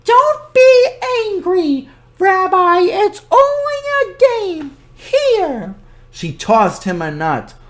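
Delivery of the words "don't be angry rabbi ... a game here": put-on high, sweet old woman; cheerful high granny voice; old grandmother voice. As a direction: play the kindly old granny talking to her guest. Baba Brena voice.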